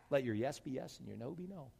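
normal talking voice

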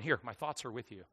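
sarcastic mock gesture of giving; lightly mocking soft aside; mock offering. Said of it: "all I can think of is somebody's opening their skull cap".

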